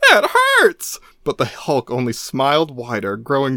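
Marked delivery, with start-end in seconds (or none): 0.1-1.0 very high, loud wail; whiny cry. Acted. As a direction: wail like a spanked child. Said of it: "Rick cried".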